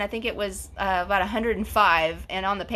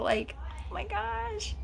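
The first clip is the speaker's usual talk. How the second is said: touched oh my gosh; 13 semitones higher, 8 dB quieter, slower than usual. Retold or imitated. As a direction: gasp out oh my gosh, much higher and airy. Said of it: "I was just kind of like".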